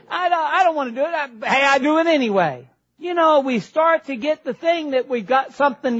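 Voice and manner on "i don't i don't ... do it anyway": higher, quick whiny confession; mock whine. Preacher voicing a sinner who does it anyway, mock whiny. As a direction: whine like a caught kid.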